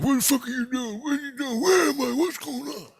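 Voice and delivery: very high, squeaky mock panic; high-pitched bewildered babble, mocking; panicked Loogie Man. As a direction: mimic someone frantic in a high falsetto. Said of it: "and he's like".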